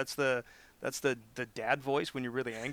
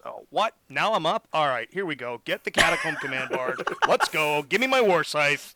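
loud mock-grumpy commander, building in force; dad jolted from a nap, now rallying troops; hammed-up battle orders; roused dad; 3 semitones higher, 10 dB louder than usual. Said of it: "waking dad up from a Sunday nap".

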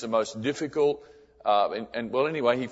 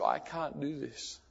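much softer and slow, a near-whispered no; crying refusal; 3 semitones higher, 10 dB quieter than usual. Retold or imitated. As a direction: let the tears choke the words. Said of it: "And he was crying".

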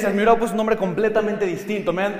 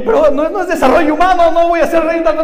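mock protest; 9 semitones higher, 8 dB louder than usual. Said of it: "Y yo".

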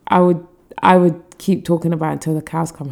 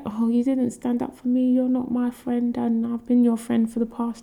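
high, softer mock-childish whine; 4 semitones higher, 6 dB quieter than usual. Mocking the cast members' childish complaint, high whine. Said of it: "really quite childish".

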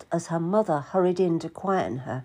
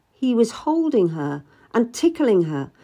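usual speaking voice

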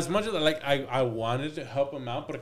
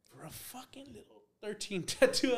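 incredulous; 11 semitones higher than usual. Retold or imitated. Disbelief at sweating client.

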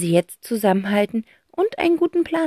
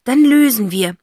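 high, loud, slow final words; determined aunt; 7 semitones higher, 7 dB louder than usual. A narrator's reading. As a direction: declare it like the plucky aunt. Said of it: "ergänzt Tante Rotz".

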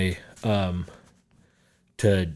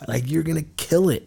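hyped encouragement; 9 semitones higher, 3 dB louder than usual. Retold or imitated. Mock pep talk to a friend.

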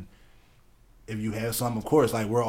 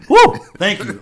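cheering; 6 semitones higher, 11 dB louder than usual. Retold or imitated. Office workers cheering the boss.

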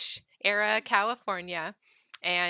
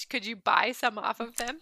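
ordinary talk, nothing marked

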